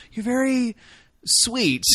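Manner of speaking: high, halting mock compliment; awkward backpedal. Woman backpedaling after sex, high halting compliment. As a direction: raise the pitch and pause before the last word.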